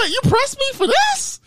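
very high, squeaky disbelief; acted indignant squeak; incredulous. Acts out incredulous reaction to stranger.

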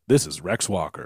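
deep movie trailer announcer; low, grand introduction. Pretend. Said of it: "sounds like a new".

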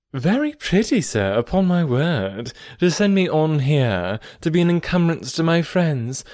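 playful mock reproach, pitch lifted; sparkling vivacity. A narrator's reading. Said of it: "greeted him with some of her sparkling vivacity".

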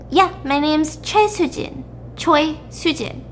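a hint of a small girl stating her name; little girl. Little girl gives her full name, lightly childlike.

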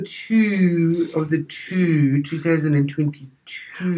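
put-on drawn-out Australian commentator accent on "two"; slow, stretched-out "two" in a commentator's accent; mock Australian commentator accent, lingering on each "two"; Richie Benaud. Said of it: "that's how Richie would have said it".

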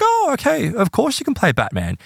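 high-pitched, obliging playing-along voice; indulgent agreement. Therapist agreeing to a child's game, high indulgent voice.

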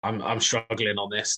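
usual speaking voice

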